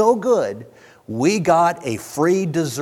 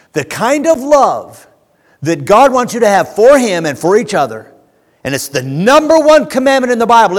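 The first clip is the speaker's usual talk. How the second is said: louder, higher, emphatic sermon delivery; preaching at full force; 6 semitones higher, 8 dB louder than usual. Acted. Preacher urging folks to choose to serve, forceful and raised. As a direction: drive each key word home, stretched and punched.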